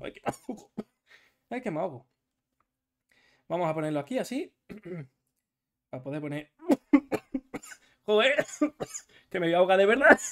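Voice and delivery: choking on coughs; coughing fit. Real, not acted. Streamer choking mid-stream, coughing. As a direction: talk through coughing.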